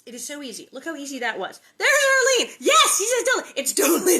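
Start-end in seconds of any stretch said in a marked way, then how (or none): 1.8-4.2 louder, higher excited call-out; thrilled she's watching; excited shout. Real, not acted. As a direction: shout her name in delight. Excited about Arlene.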